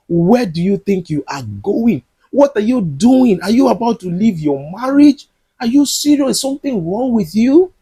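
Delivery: high, agitated questioning as the mother; alarmed mother. Retold or imitated. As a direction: fire off the questions in shocked disbelief. Mother confronts Clara leaving.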